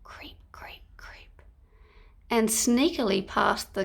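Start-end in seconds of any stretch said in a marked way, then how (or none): 0.0-1.4 barely voiced creep, creep, creep; whispered creeping. A narrator's reading. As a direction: breathe it like sneaking feet. Sneaking baddies, whispered creeping.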